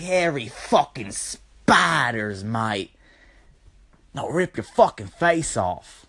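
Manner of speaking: hammed-up Aussie tough guy, slowing on the threats; Australian accent. Mock Australian threatens someone over hairy spiders, hammed-up Australian accent. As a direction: play a confrontational Australian, broad accent. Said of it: "but in like, Australian".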